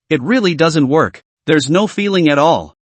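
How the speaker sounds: plain reading voice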